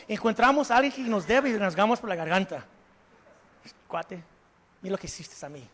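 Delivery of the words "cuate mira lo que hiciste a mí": grumbling debtor. Acted. Voicing a resentful debtor.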